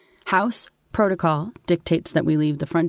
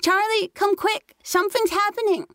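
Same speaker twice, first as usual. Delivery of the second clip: put-on high little boy's voice, calling out urgently; a six-year-old yelling for his big brother; child bellowing. A narrator's reading. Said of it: "I heard him bellowing".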